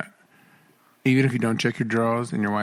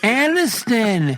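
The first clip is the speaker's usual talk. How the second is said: high, loud, drawn-out mom yell; mock-exasperated mom holler, stretched out; soccer mom; 10 semitones higher, 4 dB louder, much slower than usual. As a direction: call the kids to the car like a fed-up soccer mom. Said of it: "like a soccer mom right now".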